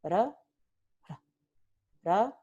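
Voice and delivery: ra with a devoiced r; r sorda; voiceless tap. Shown as a demonstration. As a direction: say ra without voicing the r. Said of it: "no vibran las cuerdas vocales".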